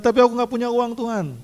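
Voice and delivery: high, pleading excuse-maker to God; whiny excuse. Retold or imitated. Believer making excuses to God, high whiny plea. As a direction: act out the lame excuse, pitched high. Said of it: "Jangan berdalih".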